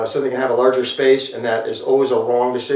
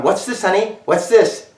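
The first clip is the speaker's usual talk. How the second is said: put-on higher, coaxing parent quizzing a small child; parent to toddler; 7 semitones higher than usual. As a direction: imitate a parent teaching first words. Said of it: "At the earliest age, they're going".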